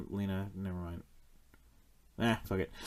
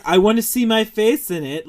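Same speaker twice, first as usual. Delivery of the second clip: higher, louder mock demand; snarky imitation; 8 semitones higher, 8 dB louder than usual. Host mimicking Buttercup ordering Wesley around, snarky. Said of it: "she's just snarky and".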